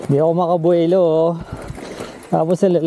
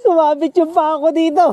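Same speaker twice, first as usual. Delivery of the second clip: laughing; 11 semitones higher than usual. Real, not acted. Laughing after bike mishap.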